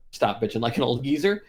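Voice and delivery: usual speaking voice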